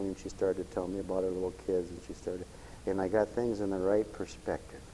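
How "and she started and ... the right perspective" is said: ordinary delivery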